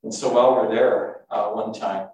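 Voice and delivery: ordinary talk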